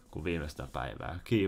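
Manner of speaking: ordinary talk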